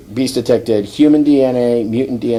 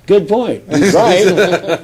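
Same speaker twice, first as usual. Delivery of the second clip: high-pitched, louder Storm imitation; comic concession; 8 semitones higher, 5 dB louder, much slower than usual. Storm agrees with the quip, comic concession. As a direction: concede it in a put-on higher voice.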